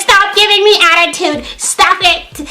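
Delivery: high, put-on indignant scolding; hammed-up high outrage; mock scolding. Mimicking roommate scolding.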